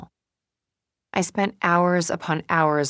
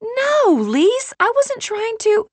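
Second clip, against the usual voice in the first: high, loud pleading objection; flustered protest; 6 dB louder, slower than usual. A narrator's reading. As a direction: protest urgently, as if misunderstood mid-quarrel. Friends quarrel, flustered protest cut off.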